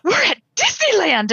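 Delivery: put-on a sleepy toddler suddenly realizing where he is; three-year-old. Mother quoting her three-year-old waking up at Disney, lightly voiced excited child. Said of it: "our three-year-old".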